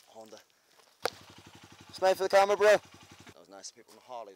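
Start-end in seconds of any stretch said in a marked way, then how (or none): 1.9-2.8 calling out. Real, not acted. Calling out to passing rider.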